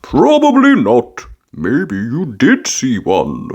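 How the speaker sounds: put-on an excited dad teasing the kids; playful, drawn-out fatherly invitation; Daddy Pig's voice. A narrator's reading. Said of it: "said Daddy Pig, winking".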